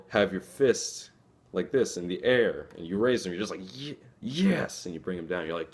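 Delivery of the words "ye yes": high, bursting little cheer; excited yes. Shown as a demonstration. Student pumping a fist over a test, excited.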